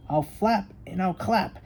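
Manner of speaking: high, loud squawking threat; squawking eagle. Acted. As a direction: screech it like a hungry bird of prey. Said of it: "he squawked".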